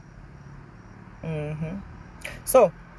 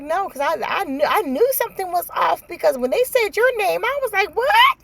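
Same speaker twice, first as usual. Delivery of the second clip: high-pitched retold disbelief, building louder to a shrieked "What?"; shocked disbelief; 12 semitones higher, 5 dB louder than usual. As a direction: imitate an incredulous friend, voice shooting way up. Retelling friends' shocked reaction.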